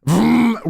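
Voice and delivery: imitated engine vroom. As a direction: mimic someone speeding away. Imitates man driving off.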